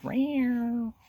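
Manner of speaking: high mewing cat voice; cat meow. Retold or imitated. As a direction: mew like a little cat.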